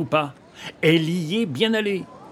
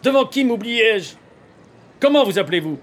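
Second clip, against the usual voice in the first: loud, raised, insistent questioning; angry demand; 5 semitones higher, 5 dB louder than usual. Acted. Young man demanding his rival's name, angry. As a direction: fire the questions out loudly.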